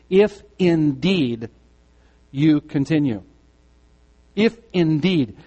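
slow, heavy stress on If indeed; weighted if indeed. Shown as a demonstration. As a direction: let If indeed land like a weight. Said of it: "this emphatic "if".

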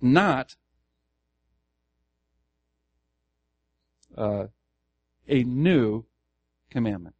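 loud "Not", pause, then loud "new", dropping on "commandment"; stress on NOT, NEW. Shown as a demonstration. Pastor demonstrates the emphases to listeners, "Not" and "new" stressed. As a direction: set "Not" and "new" apart with long pauses. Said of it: "the emphases here".

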